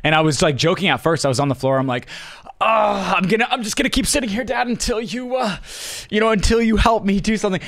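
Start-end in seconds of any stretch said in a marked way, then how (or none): 2.6-7.6 put-on higher, fast whiny kid voice; whiny plea to Dad. Stubborn kid whining at his dad from the floor, higher and fast.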